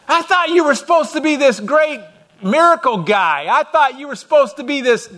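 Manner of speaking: high, loud and incredulous; shocked blind man. Acted. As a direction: act the man outraged at being spat on.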